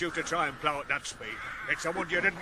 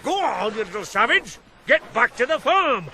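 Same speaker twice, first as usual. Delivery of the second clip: louder, higher scolding of the little savage; angry dismissal; 3 semitones higher, 6 dB louder than usual. Acted. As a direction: chase the lad off back to the farm. Driving boy away.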